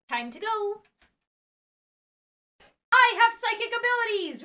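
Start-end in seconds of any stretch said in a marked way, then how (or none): none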